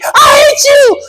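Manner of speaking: high crying shriek; sob-scream it at him; terrified wife. Retold or imitated.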